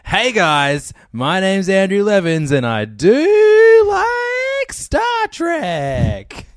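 over-the-top, stretched-out intro shout; high, loud, slow hammy holler; imitating Steele. Podcaster redoing a friend's show opening, hugely hammed-up. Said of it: "start it like Steele does".

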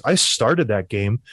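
ordinary delivery

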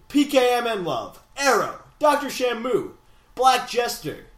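high-flung, punched names; mock-epic roll call; epic announcer. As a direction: proclaim each name like a champion's entrance. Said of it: "That was way too epic".

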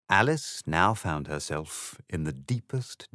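ordinary delivery

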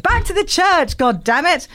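high, loud mock-outraged scolding; shrieky comic exasperation; exasperated outburst. Real, not acted. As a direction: scold your co-host at full pitch, half amused. Mock outrage at co-host's crude turn.

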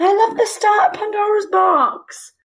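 high, loud excited shout. Real, not acted. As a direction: burst out with delight. Excited protest.

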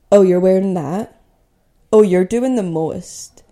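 loud, pointed imitation of critics; judgmental mockery. People commenting on her dressing up, judgmental.

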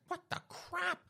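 very high, soft squeal; shocked squawk. Acted. Boyfriend hit by a dog pad, shocked squeal.

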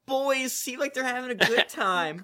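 slow, drawn-out doting granny voice; grandma. Pretend. Playing the grandma listener who likes these boys, slow grandma voice. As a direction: play an approving old grandma. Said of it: "I really wanted a grandma to be listening".

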